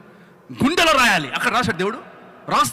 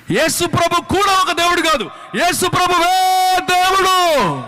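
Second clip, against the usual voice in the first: proclaiming shout; 8 semitones higher, 6 dB louder, slower than usual. Real, not acted. Climactic proclamation to the congregation.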